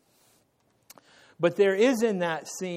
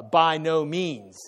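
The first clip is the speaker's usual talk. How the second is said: slow, weighty "By no means"; emphatic by no means; 4 dB louder, slower than usual. Retold or imitated. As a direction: spell out "By no means" word by word. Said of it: "is an emphatic no".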